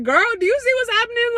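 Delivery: put-on high, fast, frantic girl talk; excited. Intern to her friend, retold high and fast.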